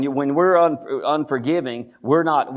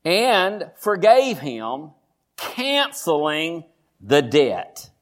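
very slow, weighted scripture reading; slow, deliberate; much slower than usual. Real, not acted. Preacher reading how the master forgave, slow and deliberate. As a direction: space the words out for emphasis. Preacher savors key verse.

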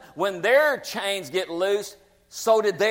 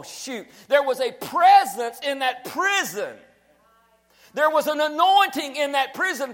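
loud, higher, shouting sermon climb; preaching at full force; 7 semitones higher, 4 dB louder than usual. Acted. Pastor rouses the church about the prison miracle, full shouting preach.

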